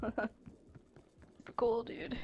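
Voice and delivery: soft sarcastic whisper. Real, not acted. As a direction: breathe it as a dry aside.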